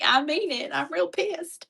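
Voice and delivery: high mock-angry hillbilly twang; hillbilly accent. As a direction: insist in hillbilly, breaking into laughter. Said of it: "it gets real hillbilly".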